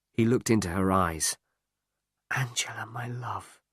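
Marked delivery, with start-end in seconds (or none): 2.3-3.6 soft unvoiced whisper; whispered endearment. A narrator's reading. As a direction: whisper it tenderly to her. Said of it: "he whispered".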